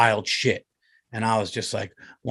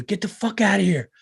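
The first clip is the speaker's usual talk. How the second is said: angry yell; 8 semitones higher than usual. Retold or imitated. Angry resident yelling at door.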